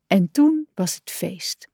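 ordinary delivery, nothing marked

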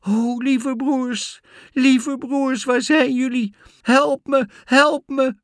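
put-on very high, loud girl's cry for help; calling out. A narrator's reading. Girl calls from the window to her brothers, pleading loudly. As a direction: call desperately out of the window. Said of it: "Daar riep ze uit het raam".

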